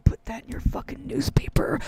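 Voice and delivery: whispered taunt; whispered threat; menacing whisper. Acted. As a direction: hiss a threat at the press. Taunting the newspaper.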